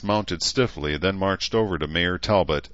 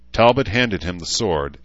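ordinary delivery

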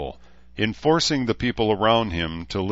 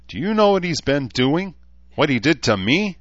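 higher, louder indignant questioning; angry demand; 8 semitones higher, 5 dB louder than usual. A narrator's reading. Danae confronts her sister about what was done to her, angry. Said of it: "Danae asked angrily".

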